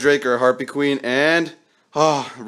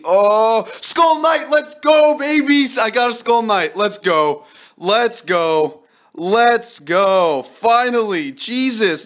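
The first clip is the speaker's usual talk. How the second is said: much higher and louder cheering, "let's go" over and over; real joy bursting out at the pull he wanted; ecstatic shouting; 10 semitones higher, 5 dB louder, much slower than usual. Real, not acted. Finally pulls Skull Knight.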